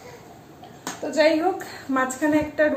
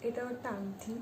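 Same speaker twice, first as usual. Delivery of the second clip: speaking softly; 16 dB quieter than usual. Real, not acted. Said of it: "আমি এই জন্য আস্তে আস্তে কথা বলছিলাম".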